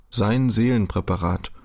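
plain reading voice

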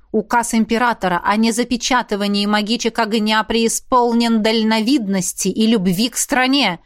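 plain reading voice

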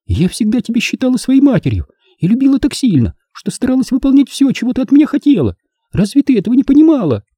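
complaining. Acted. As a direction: plead like a hurt daughter. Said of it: "а жаловалась".

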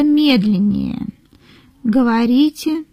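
ordinary talk, nothing marked

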